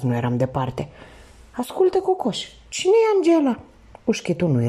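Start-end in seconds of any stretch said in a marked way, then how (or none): 1.5-3.6 high-pitched probing question; curious questioning. A narrator's reading. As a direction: ask it in a raised, sing-out voice. Thief quizzes his exhausted companion.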